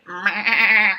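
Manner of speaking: loud bleating meh; goat bleat. Acted. Teacher voicing the goat, a loud bleat.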